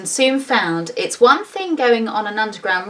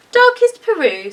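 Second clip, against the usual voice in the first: higher, louder bear answer; proudly name the far-off home; a lightly voiced little bear; 13 semitones higher, 6 dB louder than usual.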